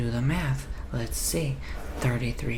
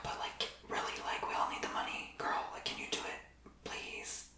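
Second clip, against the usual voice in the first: much softer, pleading to a pregnant coworker; hushed backstage plea; 11 dB quieter than usual. Acted. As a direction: keep it down so the customer won't hear.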